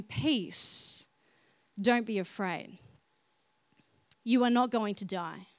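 calm a frightened man quietly; gentle reassurance. Retold or imitated. God reassures frightened Gideon.

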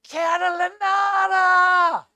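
high, slow, loud cry of the name; a girl's thrilled shout across the room; excited cry. Retold or imitated. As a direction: call the name out in delight. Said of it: "my daughter cried out".

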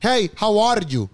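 slow, Spanish-accented "Hey, how are you?"; Puerto Rican English. Shown as a demonstration. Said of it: "Y lo dicen así". (inglés con acento boricua, lento)

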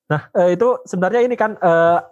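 usual speaking voice

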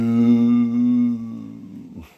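a big burst of laughter; loud laugh. Real, not acted. Vlogger cracking up at his dentist story, loud laughter.

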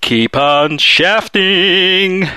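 slow, drawn-out catchphrase. Pretend. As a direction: stretch it out like a sign-off slogan. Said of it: "my usual catchphrase".

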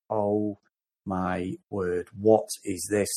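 low, drawn-out dismay; appalled disbelief. Retold or imitated. Podcaster recalling his first reaction to the film, appalled. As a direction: say it low, one heavy word at a time.

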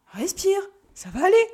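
high, insistent pep talk; emphatic, high-pitched encouragement; urgent reassurance. Acted. Brother coaches panicking twin to breathe.

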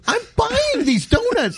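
high, slow, put-on voice; firm insistence. Wife insists she is buying the donuts, high and slow. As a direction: drag it out like a stubborn customer. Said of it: "She goes".

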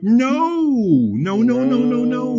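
high, drawn-out mock-desperate no; retold vehement refusal. Husband warns wife it's a trick, high mock-desperate protest.

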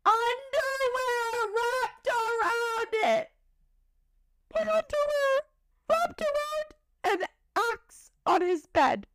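slow high crying wail over the underwear. Real, not acted. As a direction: voice breaking high into a sob. Recounting underwear on axe.

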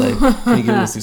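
fast, high, loud pleading rush; frantic plea. Retold or imitated. Hiding landlady begging her to hand over the TV, fast high plea. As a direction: blurt it out in a panicked hurry.

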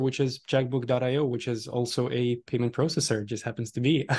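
normal talking voice

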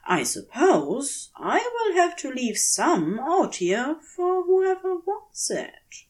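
lightly voiced prim gentleman, half to himself; gentle fussy angel. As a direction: lift the voice to play the bookish angel talking to himself. Aziraphale voiced in the story.

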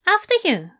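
high, polite and courteous; a gracious bow of a voice; courteous gentleman. A narrator's reading. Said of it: "he said courteously".

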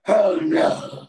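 a touch of a crying creature voice; mournful creature. Acted. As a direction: wail it like a sad sea beast. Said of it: "she looks up to you with one eye and goes".

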